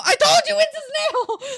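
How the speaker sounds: high squealing yell through laughter; laughing shout. Real, not acted. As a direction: shriek it while cracking up.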